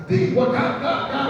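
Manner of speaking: high, hurried mock yell of cut; hammed-up director cutting the take; imitated yell. Director mockingly yells cut mid-take in a hammed-up shout.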